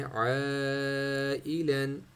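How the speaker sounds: आइलन with the ai held very long; stretched ain. Shown as a demonstration. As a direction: draw आइलन out very slowly. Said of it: "इसको हमने खींचना है".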